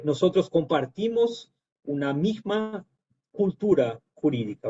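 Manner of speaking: ordinary talk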